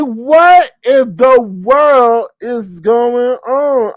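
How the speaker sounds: mock disbelief. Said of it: "I'm like".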